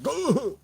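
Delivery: a sharp high yelp as the kick lands; pained grunt. Retold or imitated. Imitating the bully going down with a high yelp. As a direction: squeal like a bully kicked in the nuts. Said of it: "He just went".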